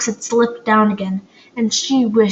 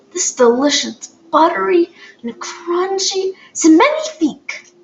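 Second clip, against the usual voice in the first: delighted; 8 semitones higher than usual. A narrator's reading. French woman delighted by cookie.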